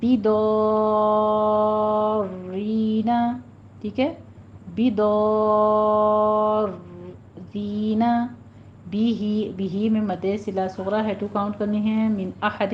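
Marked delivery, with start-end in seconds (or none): 0.0-3.1 بدارین with the یا stretched very long; long madd recitation. Shown as a demonstration. Teacher shows the long madd, stretched chant. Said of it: "آگے یا مدہ کھینچنی ہے". 4.7-8.0 بدارین with the یا stretched very long; long madd recitation. Shown as a demonstration. Teacher shows the long madd, stretched chant. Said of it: "آگے یا مدہ کھینچنی ہے".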